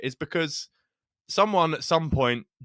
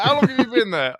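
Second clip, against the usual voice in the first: higher, louder suspicious questioning; mock nosy local; 8 semitones higher, 6 dB louder than usual. Retelling a comedian's bit, louder hammed-up nosy local. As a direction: grill the newcomer in a hammed-up voice. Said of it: "And he's like".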